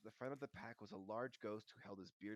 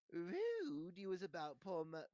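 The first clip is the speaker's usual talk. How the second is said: slow, high, loud impish scolding; Peeves, first take; 8 semitones higher, 6 dB louder, slower than usual. A narrator's reading. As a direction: drag out the taunt loudly as an imp. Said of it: "said Peeves".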